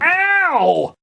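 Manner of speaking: sudden high yelp of pain. Acted. Boy slipping on the poop, a high yelp. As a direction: yelp as you hit the floor. Falls on the floor.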